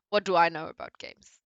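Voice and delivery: ordinary talk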